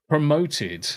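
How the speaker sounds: ordinary delivery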